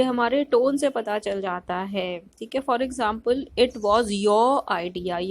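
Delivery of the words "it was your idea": "your" punched louder and drawn out; stress on your. Shown as a demonstration. Teacher showing how stress shifts meaning, stress on "your". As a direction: lean slowly and hard on "your". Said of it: "Your पे अगर हम pressure डाल रहे हैं".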